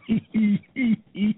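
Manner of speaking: hearty burst of laughter. Real, not acted. Laughs after his mock threat.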